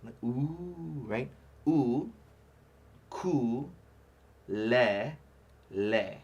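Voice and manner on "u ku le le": "U, ku, le, le" each syllable apart with pauses; syllable breakdown. Shown as a demonstration. Teacher breaks ukulele down for the class. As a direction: break "U, ku, le, le" into slow separate syllables. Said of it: "if we break it down".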